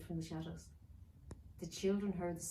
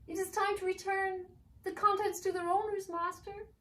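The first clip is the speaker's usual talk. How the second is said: very high, louder elf pleading; 13 semitones higher, 5 dB louder than usual. Acted. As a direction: servant begging the master.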